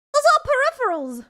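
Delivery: exasperated insistence. Real, not acted. Streamer corrects chat on the desk clutter, exasperated. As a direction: insist on it in a high, loud voice.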